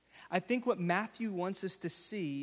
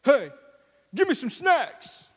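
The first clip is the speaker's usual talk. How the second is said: loud, much higher demanding dad voice; kids imitating daddy; 8 semitones higher, 10 dB louder than usual. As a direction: bark for snacks like a hungry dad. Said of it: "starts to sound like this".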